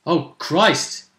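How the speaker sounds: usual speaking voice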